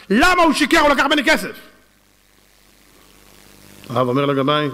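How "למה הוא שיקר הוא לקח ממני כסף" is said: high, indignant retold complaint; aggrieved outburst pitched well up; acted accusation. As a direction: protest like a cheated plaintiff. Dead man accuses gabbai before rabbi.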